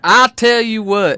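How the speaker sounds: slow, high, loud hammed-up Southern twang; over-the-top Southern good ol' boy; Southern accent. Joe trying again, exaggerated Southern accent. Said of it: "speak in a Southern accent".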